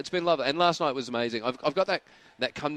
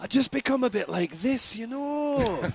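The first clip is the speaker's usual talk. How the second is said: high sing-song Scottish impression; Billy Connolly; 11 semitones higher, slower than usual. Comedian tries Scottish as a comic, high sing-song impression. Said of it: "I can do Billy Connolly".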